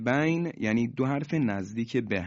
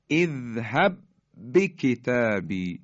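slow, louder tajweed chant of the verse; Quranic recitation; 4 dB louder, slower than usual. Shown as a demonstration. Teacher showing the merged letters to students, slow louder chant. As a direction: recite it carefully, each sound held. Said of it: "دقت بفرمایید".

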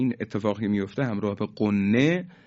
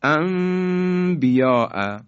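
انبیاء stretched very slowly as ambiya', higher; Quran recitation; 5 semitones higher, much slower than usual. Shown as a demonstration. Teacher reciting the word to model iqlab. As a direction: chant انبیاء slowly with held nasal mim. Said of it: "این شد اقلاب".

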